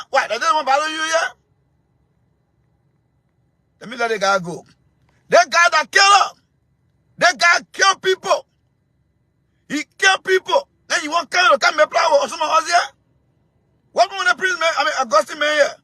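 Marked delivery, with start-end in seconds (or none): 5.3-10.7 angry shout. Real, not acted. Angry caller shouting at Augustine.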